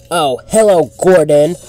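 loud, high startled challenge; indignant surprise. Acted. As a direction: confront an unwelcome rival in disbelief. Startled engine confronts returning rival.

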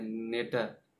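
नेटर with a hard t sound; wrong pronunciation. Shown as a demonstration. Teacher to class, repeating the wrong pronunciation. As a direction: repeat the mistaken form नेटर. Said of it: "नेटर नहीं बोलते हैं".

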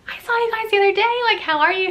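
high, quick cheery greeting; friendly guest greeting. Retold or imitated. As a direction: imitate greeting returning guests in a high chirp. Acted friendly greeting to returning guests.

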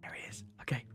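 barely audible whisper; whisper, hiding. Real, not acted. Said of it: "Why are we whispering?".

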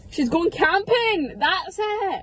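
loud, high and delighted; excited exclamation. Real, not acted. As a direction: burst out with the discovery. Joking realization among friends.

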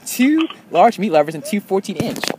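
loud, high exasperated protest. Real, not acted. Exasperated correcting the misheard order.

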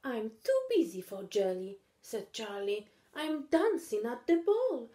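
a hint of an impatient kid; busy little cat. Charlie as dancer.

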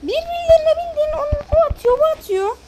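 loud, high exasperated yell at teammates; frustrated yelling. Real, not acted. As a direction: yell in disbelief at the players.

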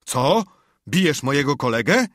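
high, loud outraged shout; drunken yell. A narrator's reading. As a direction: bellow it at the doctor in drunken fury. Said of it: "i wrzasnął".

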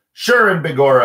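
Irish exclamation. Acted. Leprechaun exclaims.

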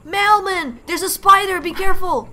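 high, loud mock-urgent shout; calling a warning. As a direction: shout a playful warning to a game character. Calling warning to game character.

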